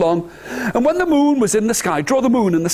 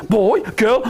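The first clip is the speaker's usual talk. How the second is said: ordinary talk, nothing marked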